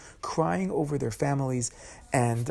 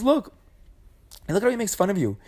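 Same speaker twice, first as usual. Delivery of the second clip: mock whiny wife; 7 semitones higher than usual. Korach's wife complaining to Korach.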